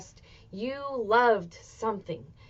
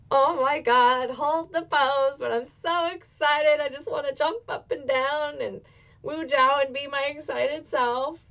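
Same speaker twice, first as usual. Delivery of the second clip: retold excitement; 5 semitones higher, 4 dB louder than usual. Retold joy after burlesque show.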